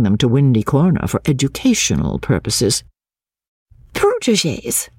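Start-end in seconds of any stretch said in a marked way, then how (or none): 3.9-4.9 high, heated exclamation; exclaimed with warmth. A narrator's reading. Lucy objecting to Cecil's word, exclaimed with warmth. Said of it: "she exclaimed with some warmth".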